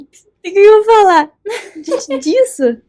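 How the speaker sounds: high, loud cry of helpless amazement; overwhelmed cry. Retold or imitated. Loud high cry after hearing the driver wanted to die. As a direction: cry it out, totally lost for words.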